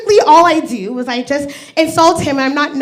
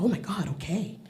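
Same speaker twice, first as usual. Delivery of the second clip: awed hush; 7 semitones lower, 17 dB quieter than usual. Retold or imitated. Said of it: "I'm like".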